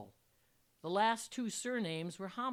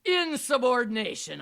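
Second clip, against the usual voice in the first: loud, raised angry growl; furious teacher's loud retort; 6 semitones higher, 13 dB louder than usual. A narrator's reading. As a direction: growl it forcefully at the student. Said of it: "I growled".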